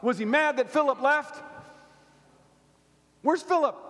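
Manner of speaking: high, loud, fast preaching climb; thundering sermon climax; preaching at full force. Acted. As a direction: preach it up, fired up.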